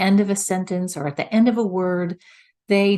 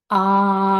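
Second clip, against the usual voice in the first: full clear ah on good breath; supported clear ah; 5 dB louder than usual. Shown as a demonstration. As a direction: sing out a clean steady ah. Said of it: "it sounds more like this".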